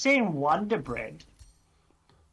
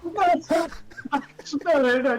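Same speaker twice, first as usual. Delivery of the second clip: laughing protest; 12 semitones higher, 4 dB louder, much slower than usual. Real, not acted. Laughing protest at friend's joke.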